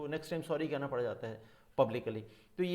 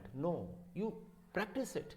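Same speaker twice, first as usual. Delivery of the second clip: usual speaking voice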